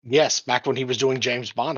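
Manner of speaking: usual speaking voice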